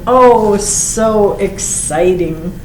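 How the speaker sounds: ordinary delivery, nothing marked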